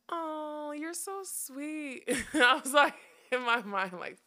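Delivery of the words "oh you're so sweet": a much higher, slow, sugary mock voice; imitating the girl. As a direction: stretch it out like talking to a puppy. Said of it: "one was like".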